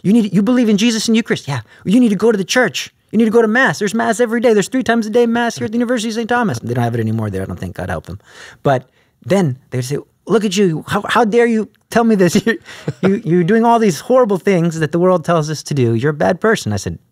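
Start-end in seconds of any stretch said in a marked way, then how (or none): 0.0-6.6 higher, fast, hammed-up preachy voice; mock preachy self. Student urging classmates to go to Mass, hammed-up preachy voice. 10.2-16.7 higher, mock-indignant scolding; indignant classmate. Good classmates scolding him as a hypocrite, mock indignant.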